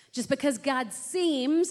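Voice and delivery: slow, high emphasis on "seems"; stress on "seems". Real, not acted. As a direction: lean slowly on the word "seems". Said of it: "and I say seems".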